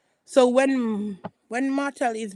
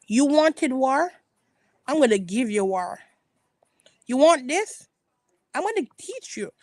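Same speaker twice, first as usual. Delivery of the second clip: challenging questions pitched up, imitating a fighter; mock defiant threat; 3 semitones higher, 4 dB louder than usual. Voicing a woman who won't back down, defiant. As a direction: throw down the challenge as if voicing someone else.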